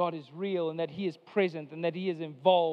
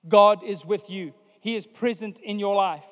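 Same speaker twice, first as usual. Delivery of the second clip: emphatic proclamation; 9 dB louder than usual. Acted. Preacher's key point.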